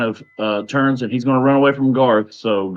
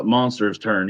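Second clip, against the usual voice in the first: ordinary talk, nothing marked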